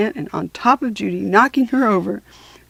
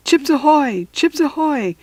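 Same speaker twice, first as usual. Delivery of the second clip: crackled over walkie-talkie; 6 semitones higher, 4 dB louder than usual. Acted. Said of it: "crackled Stink".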